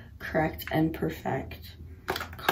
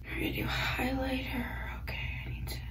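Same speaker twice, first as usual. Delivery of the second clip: whispering; 5 semitones higher, 9 dB quieter, faster than usual. Real, not acted. Whispering during makeup.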